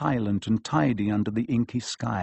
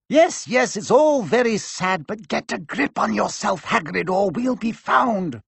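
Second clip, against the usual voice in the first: much higher, brisk stern witch; higher voice of an impatient teacher; McGonagall voice; 8 semitones higher than usual. A narrator's reading. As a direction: hurry him along impatiently.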